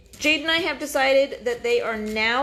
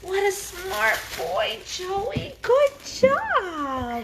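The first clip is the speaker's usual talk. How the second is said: squeaky praising a dog; 10 semitones higher, much slower than usual. Real, not acted. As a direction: gush over the clever pup in a squeaky voice. Praising the first puppy through.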